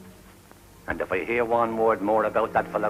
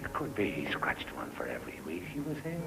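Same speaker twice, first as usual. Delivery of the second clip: low, soft, half-whispered musing; hushed pondering; 5 semitones lower, 7 dB quieter than usual. Acted. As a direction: think it through under your breath. Pondering marks left by castaway.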